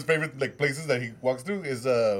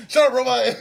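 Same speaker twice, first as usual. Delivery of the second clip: higher, louder, half-laughing snap at a robot; mock yell; 10 semitones higher, 8 dB louder than usual. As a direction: bark at the robot playfully. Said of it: "you're like".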